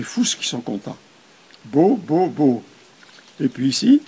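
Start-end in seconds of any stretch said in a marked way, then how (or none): 1.7-2.6 BEAU repeated briskly and loudly; rapid reading. Retold or imitated. Teacher imitating learners who recognise what they learned, rapid reading. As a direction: rattle off BEAU like a delighted new reader. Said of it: "ils vont le lire à toute vitesse".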